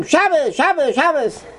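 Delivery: high-pitched mock yell, no real volume; mock scream. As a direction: call it out high, like a protester. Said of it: "You have to scream".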